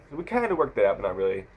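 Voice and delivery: usual speaking voice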